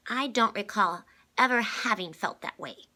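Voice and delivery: put-on high, loud child voice; a small girl puzzled and insistent; little girl Constance. A narrator's reading.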